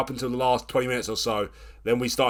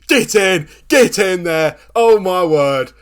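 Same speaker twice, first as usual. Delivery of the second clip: loud, high triumphant yell; celebratory shout; 7 semitones higher, 10 dB louder, much slower than usual. Real, not acted. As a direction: roar the celebration. Celebrating winning penalty.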